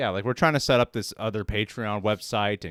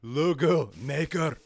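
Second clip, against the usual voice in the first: higher, put-on Scottish; bad Scottish accent; 5 semitones higher than usual. Co-host attempting Scottish for host, bad accent. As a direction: have a go at a clumsy Scottish accent. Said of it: "Your best Scottish is".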